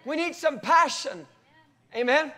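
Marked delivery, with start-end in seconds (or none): 0.1-1.3 higher, slow, louder plea; crying out to God. Retold or imitated. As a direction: call up to heaven with hunger. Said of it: "the body of Christ worldwide and say".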